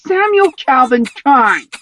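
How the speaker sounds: high, loud, slow name call; mother shouts his full name; cried out. A narrator's reading. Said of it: "cried Senny".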